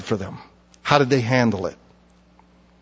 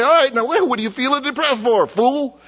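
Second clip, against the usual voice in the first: scolding self-talk; 8 semitones higher than usual. Shown as a demonstration. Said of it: "you stop and you say".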